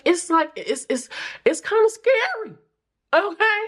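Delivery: high, nervous squeak; nervous squeaky. Real, not acted. Admits awkwardness, voice rises.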